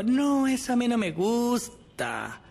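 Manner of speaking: más agudo y lento, desganado; rechazo quejumbroso. Retold or imitated. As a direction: suelta el no como niño caprichoso. Imita el rechazo del otro. (imitando un rechazo caprichoso)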